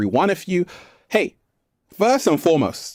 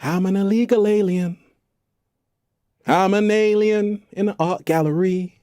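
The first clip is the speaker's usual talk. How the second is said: playful alien musing; 6 semitones higher than usual. Retold or imitated. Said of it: "'cause I'm like".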